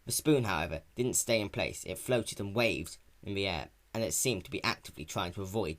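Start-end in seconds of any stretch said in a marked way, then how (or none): none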